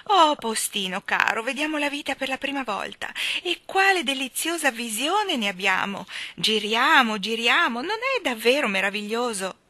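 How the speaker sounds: high, gushing enthusiasm; joyous delight. A narrator's reading. Miss Tartina to the postman as they whirl, joyous delight. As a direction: gush with delight at a new view of life. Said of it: "gridò giocondamente la signorina Tartina".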